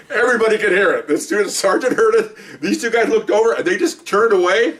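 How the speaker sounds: laughing so hard the words barely hold; real laughter. Real, not acted. Laughing while telling the punchline.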